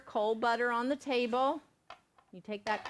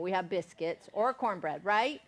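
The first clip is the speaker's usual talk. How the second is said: ordinary talk, nothing marked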